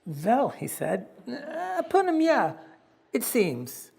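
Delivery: put-on high, hesitant Yiddish-accented boy; foreign Yiddish accent. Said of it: "realized how foreign his words sounded".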